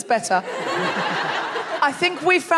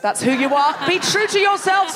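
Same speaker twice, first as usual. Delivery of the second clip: normal talking voice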